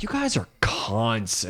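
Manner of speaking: quiet, slow retold insult, not yelling; muttered insult. Retelling the bar fight to friends, quiet slow insult. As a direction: say it softly and slowly, no shout. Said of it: "I wasn't even yelling".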